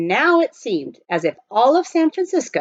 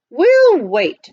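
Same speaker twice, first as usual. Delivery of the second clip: firm, louder and higher; decisive; 7 semitones higher, 8 dB louder than usual. A narrator's reading. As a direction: settle it with a firm word. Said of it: "said Praiseworthy with decision".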